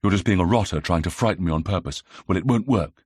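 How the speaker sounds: plain reading voice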